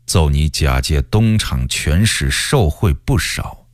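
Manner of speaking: measured and low, with long pauses; unhurried imperial threat; slow, deliberate warning. A narrator's reading. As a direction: let the warning out slowly, coldly. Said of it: "才徐徐地说".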